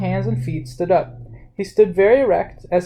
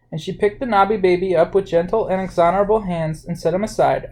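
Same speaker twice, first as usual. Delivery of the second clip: ordinary delivery, nothing marked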